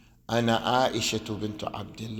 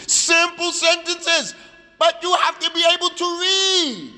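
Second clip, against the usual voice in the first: emphatic insistence; 6 dB louder than usual. Real, not acted. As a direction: raise your voice and hammer the point home. Teacher pressing the requirement on students.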